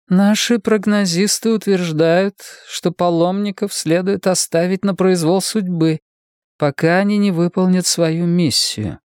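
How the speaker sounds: high, measured voice of a woman in power; official woman leader. Acted. Voices Gladstone stating the verdict. (официальный женский голос, повыше)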